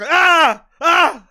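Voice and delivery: high, loud short screams; mock scream. Shown as a demonstration. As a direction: yelp like a startled streamer. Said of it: "instead of screaming".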